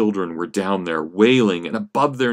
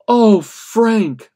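sobbing; 12 semitones higher, 8 dB louder than usual. Acted. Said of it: "Allegra sobbed".

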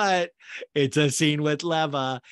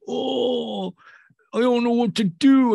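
high, loud mock whine; pouty, hammed-up high moan; mock pouting; 9 semitones higher, 6 dB louder than usual. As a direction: imitate a sulker wailing helplessly. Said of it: "is all pouting".

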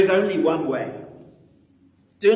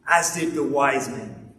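ordinary talk

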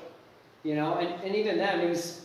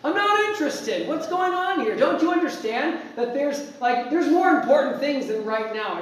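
high, loud, disbelieving rant; fed-up refusal of a TV salesman; exasperated outburst; 7 semitones higher, 4 dB louder than usual. Retold or imitated. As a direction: throw the questions back in exasperation. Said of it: "You're like".

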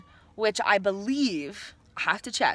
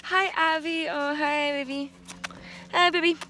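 tender; 9 semitones higher, 5 dB louder than usual. Real, not acted. Greeting inner child part tenderly.

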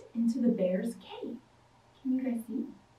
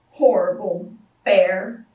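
angry refrain; 7 semitones lower, 7 dB louder than usual. A narrator's reading. Girl stomping angry refrain.

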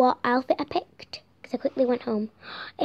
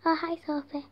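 usual speaking voice

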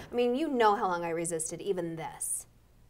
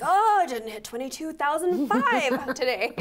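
higher, louder, slow fretting; mock dismay; 6 semitones higher, 5 dB louder, slower than usual. Host mocking her step obsession, playful dismay. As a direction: moan over a missed step count. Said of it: "I'm like".

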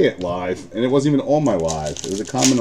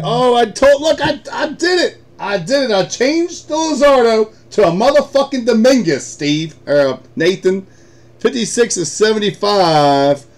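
high, loud, whooping triumph; ecstatic yelling; 13 semitones higher, 7 dB louder than usual. Real, not acted. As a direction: shout it out like you just won big. His trade just hit live.

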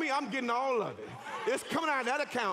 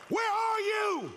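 high drawn-out call, louder and slower; calling cry; 8 semitones higher, 3 dB louder, slower than usual. Pretend. Preacher voicing the harvest crying out for its owner, high call. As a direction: call out searching across a field. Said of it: "two cries going out".

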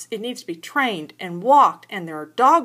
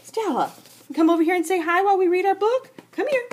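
high coaxing call; 11 semitones higher, 4 dB louder than usual. Real, not acted. Reader calls the toddler.

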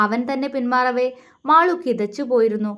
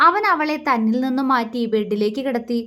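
ordinary delivery

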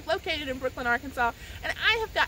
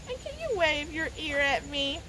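ordinary delivery

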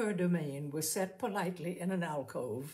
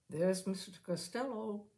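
usual speaking voice

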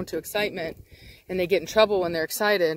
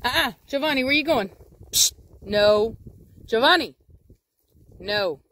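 calling dog; 4 semitones higher, 3 dB louder than usual. Real, not acted. Calling straying dog back.

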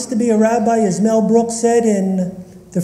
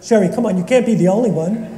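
ordinary talk

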